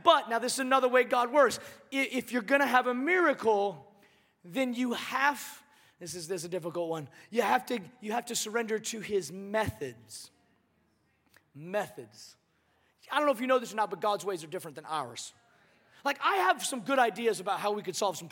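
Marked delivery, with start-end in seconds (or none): 6.0-12.4 quiet, earnest. Real, not acted. Pastor slows on hard truth.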